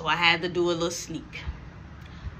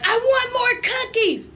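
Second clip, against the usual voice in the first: put-on much higher, slow whiny child voice; little girl voice; 4 dB louder, slower than usual. Little sister begging for cookies in a high whine. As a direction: whine it like a small kid begging. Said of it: "you hear Kayla like".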